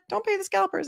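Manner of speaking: imitated high, squeaky helium voice. Rock star advising two ticketless girls, high squeaky voice. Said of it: "really high, squeaky voice".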